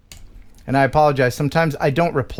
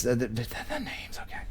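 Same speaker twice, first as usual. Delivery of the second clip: whisper; 6 semitones lower, 15 dB quieter than usual. Real, not acted. Stumbling over a name.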